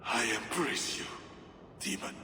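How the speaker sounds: soft, almost under the breath, to the demon within; softly embracing the inner demon; hushed. Acted. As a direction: murmur the embrace quietly.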